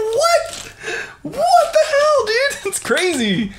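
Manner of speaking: very high-pitched laughing disbelief; real shock, voice shooting way up; incredulous. Real, not acted. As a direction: squeal it in amazement, stretching the words. Incredulous at fans' attack.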